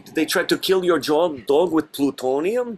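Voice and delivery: high, loud mock Russian accent. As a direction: ham it up in thick Russian. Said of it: "I get to do ... a Russian accent".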